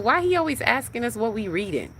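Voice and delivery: imitating own baffled, high-pitched question; puzzled complaint. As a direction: ask it way up high, mock-bewildered. Retells own puzzled reaction to father.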